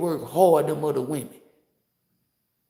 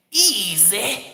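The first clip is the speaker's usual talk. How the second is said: "Easy" stretched, rising and a bit louder; sing-song easy; 3 semitones higher, 3 dB louder than usual. Shown as a demonstration. Telling viewers to repeat after him, a stretched rising "Easy". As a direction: teach them to sing out "Easy". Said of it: "And say how I'm saying it".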